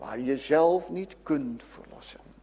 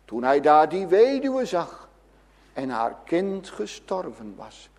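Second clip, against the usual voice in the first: ordinary talk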